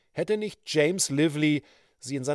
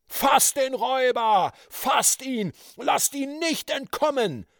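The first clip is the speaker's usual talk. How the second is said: higher, urgent shouts; crowd shouting; 12 semitones higher than usual. A narrator's reading. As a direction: yell to seize the robber. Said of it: "schrie es von allen Seiten".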